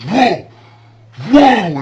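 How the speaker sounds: high, loud startled whoa; startled yell. Retold or imitated. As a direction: yelp in shock at a ghost. Startled by old lady ghost.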